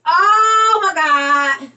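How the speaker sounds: high, loud amazed shrieks; overjoyed squealing; shrieking excitement. Real, not acted. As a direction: scream it in disbelief. Shrieking at the brightening bulb.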